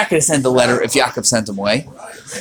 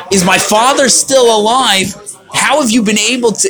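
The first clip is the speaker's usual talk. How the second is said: sarcastic; 6 semitones higher than usual. Retold or imitated. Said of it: "He's saying".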